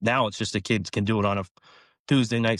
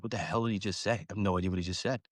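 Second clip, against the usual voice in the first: softer, a confused side comment to a teammate; hushed aside; 6 dB quieter than usual. Retold or imitated. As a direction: mutter it under your breath to the kid next to you.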